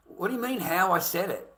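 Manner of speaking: high-pitched baffled protest; incredulous. Retold or imitated. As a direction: act out disbelief at the accusation. Retells baffled reply to questioning.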